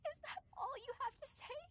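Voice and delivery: high-pitched tearful challenge; crying. Acted. Upset companion challenges a swordsman's reaction, crying. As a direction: push the question out through tears. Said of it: "You really are crying".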